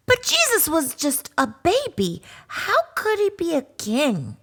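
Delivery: a cub doubting mama; high puzzled cub voice; puzzled little cub. Acted.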